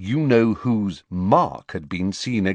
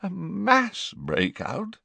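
hoarse repeat; 11 semitones higher, 3 dB quieter, slower than usual. Acted. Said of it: "repeated hoarsely".

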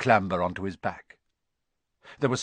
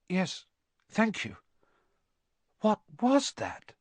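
put-on shaken boy; 9 semitones higher than usual. A narrator's reading. Shaken boy answers centaur.

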